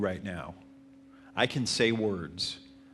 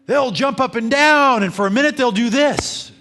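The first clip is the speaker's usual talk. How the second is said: much higher and louder, bursting with energy; high-energy showman; 14 dB louder than usual. Shown as a demonstration. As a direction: jump up and down with your voice, big and bright. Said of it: "real professionals who do this stuff".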